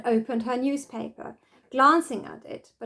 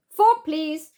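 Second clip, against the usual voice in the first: a bright, exclaimed request, pitched up; 6 semitones higher, 6 dB louder than usual. A narrator's reading. Bella calling to her cousins for the fork, exclaimed. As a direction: call for the fork with eager urgency. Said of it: "exclaimed Bella".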